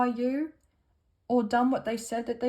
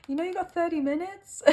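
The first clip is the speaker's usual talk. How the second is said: higher put-on American Irish accent; a playful stab at an Irish lilt; weird American Irish accent; 6 semitones higher than usual. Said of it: "weird American Irish accent".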